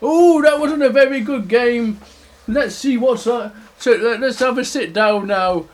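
lightly voiced storybook bear; high, loud start easing down; mild grumble settling toward calm; put-out bear. Big bear settles after being pricked.

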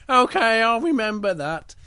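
high-pitched little bear voice. Acted. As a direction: agree in a small, high story voice. Little Bear agrees to the warning.

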